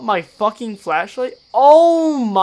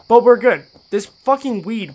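ordinary talk, nothing marked

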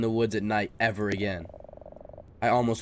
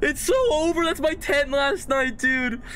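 high, loud, slow wail; panic, screaming and crying out; terrified scream; 3 dB louder, slower than usual. Real, not acted. Sees picture of his tent.

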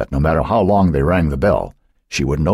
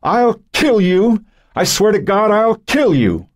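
high, drawn-out frantic cry; screamed threat; 3 dB louder, slower than usual. A narrator's reading. Joan threatening Cynthia to stop her telling, screaming. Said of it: "she screamed".

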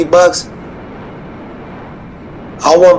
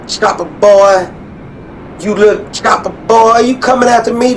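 higher, teasing sing-song taunt at the pilot; mocking taunt; 7 semitones higher than usual. Real, not acted. Taunting enemy helicopter in game.

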